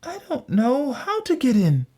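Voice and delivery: put-on high-pitched little girl voice; little Gretel. A narrator's reading. Gretel feigning ignorance to the witch, high girlish voice. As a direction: play innocent in a high child's voice. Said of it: "Gretel guessed what she must be thinking and said".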